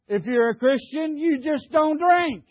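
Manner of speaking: forceful preacher's restatement; emphatic repeat. Real, not acted. Repeats after "Did you hear.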